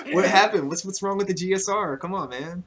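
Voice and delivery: high-pitched ribbing after a laugh; teasing disbelief. Real, not acted. Friend ribbing George for taking L's, teasing disbelief.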